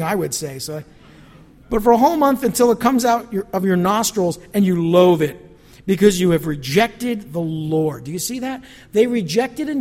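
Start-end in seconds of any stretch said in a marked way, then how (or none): 1.7-8.0 loud, proclaiming rebuke; forceful scripture reading. Acted. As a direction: thunder it out as God's word. Rereading verse with force.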